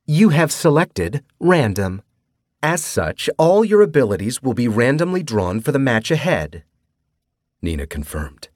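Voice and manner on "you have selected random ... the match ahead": a chirpy game hostess announcing; cutesy AI voice. A narrator's reading. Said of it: "The cutesy voice of Nina".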